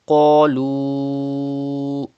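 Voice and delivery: Qalu with a heavy qaf and the u held five counts; five-beat madda. Shown as a demonstration. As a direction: hold the long u of Qalu for five beats. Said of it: "stretch up to five harkat like this".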